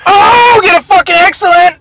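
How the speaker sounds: high, loud outburst; frantic gamer yell. Real, not acted. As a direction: shout at the game to deliver. Urging a throw in game.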